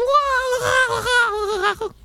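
high warbling cartoon wail; long high-pitched silly running cry; SpongeBob. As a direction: babble shrilly like a cartoon running away.

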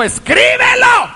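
high, slow shouted command; commanding angel. Acted. Preacher voicing the angel ordering John, shouted high. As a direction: ring it out like a heavenly order.

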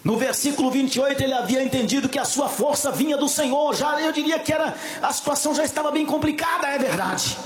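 full-force preaching. Acted. Preacher rousing the church about Samson's strength, full-force preaching. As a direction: preach it at full volume, pressing on without letup.

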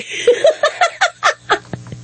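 laughing at the impression; high incredulous laugh; high amused laugh. Real, not acted. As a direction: break into giggles. Laughs at her own impression.

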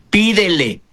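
ordinary talk, nothing marked

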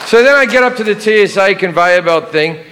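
ordinary talk, nothing marked

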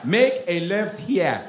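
slow, bossy Nigerian navigation voice; a light Nigerian accent; Nigerian GPS voice. Retold or imitated. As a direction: give directions like a strict Nigerian GPS. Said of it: "I have African G P S".

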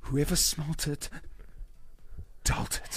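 slow, frightened whisper; scared whisper. Pretend. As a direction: breathe it out in terror. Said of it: "I love how we're all whispering".

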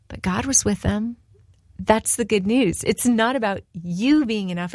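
ordinary talk, nothing marked

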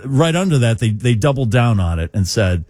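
normal talking voice

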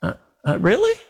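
whispered stammer, then a leap up to a squeaky "really?"; incredulous huh. Retold or imitated. Doubter answering God's promise, mock disbelief. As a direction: play dumb, then feign shock. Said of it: "Or I can say".

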